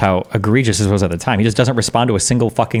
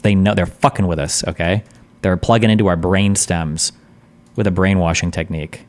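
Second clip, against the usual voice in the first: ordinary talk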